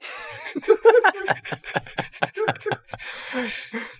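a long high burst of laughter. Real, not acted. Laughs at own bluntness. (tertawa lepas bernada tinggi)

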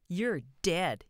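put-on loud childish cry. A narrator's reading. Boy firing toy pistol at George, crying out. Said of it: "he cried".

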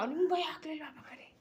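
soft, breath-only whisper; hushed question. Real, not acted. Teacher asks little boy if he was scared, whispered. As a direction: ask it softly, almost without voice.

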